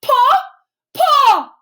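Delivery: high, loud cries for a father; desperate calling out. A narrator's reading. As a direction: shout desperately for your dad. Bear cries for endangered father.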